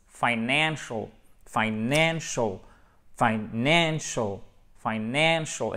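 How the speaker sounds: FINANCIAL slowly, with a stretched NAN; drawn-out teacher's model of FINANCIAL; stress on NAN. Shown as a demonstration. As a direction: drill "fy-NAAN-shul", falling at the end. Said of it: "notre accent tonique ici".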